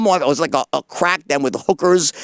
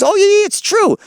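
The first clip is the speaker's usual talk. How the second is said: very high, louder mock confirmation; imitated breezy admission; 5 dB louder than usual. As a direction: blurt it in a high, loud voice. Retold friend's gleeful confession.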